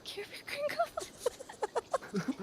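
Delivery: high, slow, silly squeaky echo. Pretend. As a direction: stretch it out in a goofy high voice.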